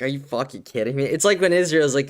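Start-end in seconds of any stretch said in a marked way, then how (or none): none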